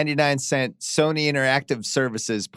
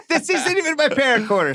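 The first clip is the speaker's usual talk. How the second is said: mock indignant; 12 semitones higher, 3 dB louder than usual. Co-host jokes, higher voice.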